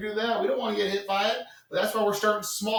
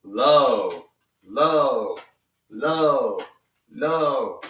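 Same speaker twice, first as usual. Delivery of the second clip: each LOW stressed, falling and loud; emphatic repeated low; 4 semitones lower, 5 dB louder, much slower than usual. Shown as a demonstration. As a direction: hammer the word "low" each time. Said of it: "keep everything low".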